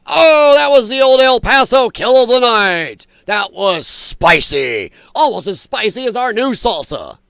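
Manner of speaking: loud, hyped-up sponsor plug; mock game-show announcer. Announcer plugging salsa after a kill, mock hype. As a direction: boom it out, stretching the key words.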